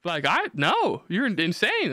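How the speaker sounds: high, horrified mock woman's voice; hammed-up appalled woman, voice jumping high; appalled girlfriend. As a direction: recoil as the horrified new girlfriend. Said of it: "and just like".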